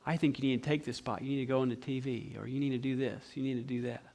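low, hushed acted counsel; quiet insinuating advice. Devil posing as Grandma giving advice, quietly insinuating. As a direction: drop low and soft, planting the suggestion.

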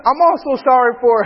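high imitated child, amused; little son apologizing. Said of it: "Zen is the first one".

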